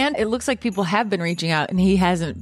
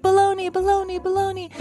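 higher voice, echoing the word back, fading away; 12 semitones higher than usual. Retold or imitated. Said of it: "I'd be like".

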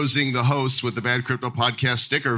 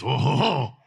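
light mock French accent; 2 semitones higher than usual. Host teases the French contestant, mock French accent. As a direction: put on a little French accent. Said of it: "when I do my French accent".

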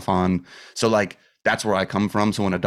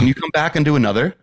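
retold doctor's request; 7 semitones higher, 5 dB louder, faster than usual. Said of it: "she calls me three days later and goes".

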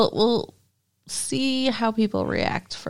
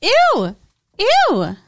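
high loud repeated ew; disgusted ew; 9 semitones higher, 6 dB louder, much slower than usual. Real, not acted. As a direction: squeal in disgust. Disgust at mother story.

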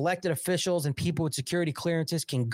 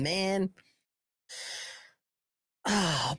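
ordinary talk, nothing marked

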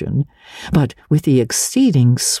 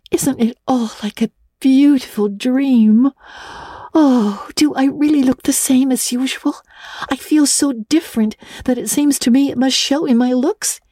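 put-on higher, breathless young girl voice; a dreamy girl marvelling at the night; eager girl Anne; 10 semitones higher than usual. A narrator's reading. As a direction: Anne whispering her wonder to Diana.

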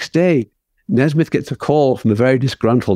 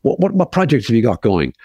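a brash Hollywood manager, pitched up; manager's voice; 6 semitones higher than usual. Retold or imitated. Retelling the manager's pushy question at the bar. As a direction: play the pushy player fishing for news. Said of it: "the manager, goes".

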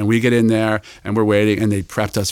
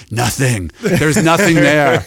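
loud, higher, drawn-out retold put-down; emphatic mock dismissal; 5 semitones higher, 5 dB louder, much slower than usual. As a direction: hammer the dismissal home slowly, imitating the boss. Jobs dismissing Waltham, retold punchline.

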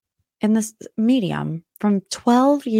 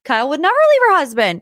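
much higher, fast gullible protest; mock naive denial; 7 semitones higher, 3 dB louder than usual. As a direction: deny it like a starry-eyed fan. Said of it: "I'm like".

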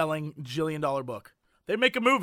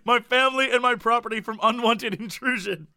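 ordinary delivery

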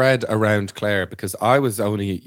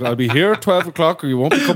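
stern taxi driver; 6 semitones higher, 5 dB louder than usual. Retold or imitated. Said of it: "It's like".

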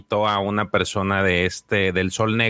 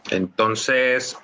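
usual speaking voice

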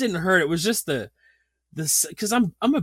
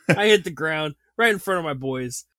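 ordinary talk, nothing marked

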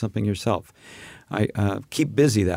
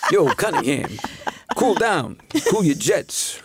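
Anson Williams impression; 6 semitones higher, slower than usual. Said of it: "Anson Williams".

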